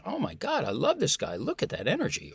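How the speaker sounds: high-pitched gush at the start, settling; acted-out thrill at someone's energy; delighted admiration. Imagined interviewer loves this guy's energy, acted excitement. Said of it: "beginning to conjure up that emotion".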